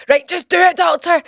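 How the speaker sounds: imitating an urgent, high-pitched order; exasperated dad. Dad urging doctor while holding her.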